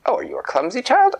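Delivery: high, quick condescending queen; sneering royal voice, high and fast; leprechaun queen. Acted.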